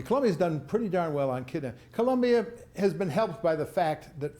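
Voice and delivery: normal talking voice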